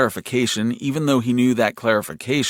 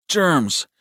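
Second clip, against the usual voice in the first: higher, falling one-word answer; a fixed, repeated reply in a higher voice; insistent little brother; 6 semitones higher than usual. A narrator's reading. Frowning brother at the towel machine, insistent.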